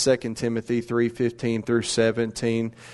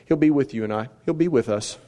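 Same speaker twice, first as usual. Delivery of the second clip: ordinary delivery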